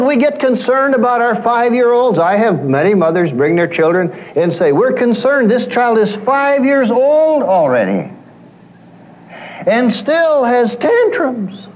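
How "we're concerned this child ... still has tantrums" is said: high, slow quoted worried mother. Retold or imitated. Preacher mimics a concerned mother: high, slow worry. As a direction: imitate a fretting mother, slowing down.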